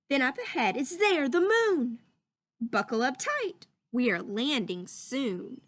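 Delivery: put-on lively storybook reading for children; read-aloud voice. Teacher reading a story to her class, lively read-aloud. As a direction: read it like a picture book to the class.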